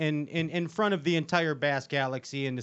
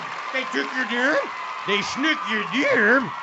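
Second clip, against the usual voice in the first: mock shout; 7 semitones higher than usual. Joking shout about the name.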